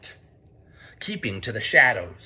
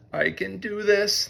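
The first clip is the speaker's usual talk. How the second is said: mole thinking; 7 semitones higher than usual. A narrator's reading. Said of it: "thought Mole".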